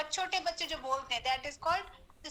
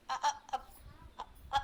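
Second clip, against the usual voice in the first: stuck on uh, uh before the words; stammer demo; 4 dB quieter than usual. Shown as a demonstration. Teacher showing students how a stammer sounds, halting. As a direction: show a stammer, stalling on the first sound. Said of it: "हकलाते हैं".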